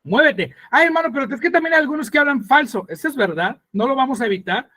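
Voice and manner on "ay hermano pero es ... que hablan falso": objecting congregant. Acted. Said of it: "objection from a church member".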